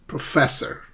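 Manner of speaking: "Professor" as pro-FESS-or; careful model pro-FESS-or; second-syllable stress. Shown as a demonstration. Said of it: "pronounce these words after me".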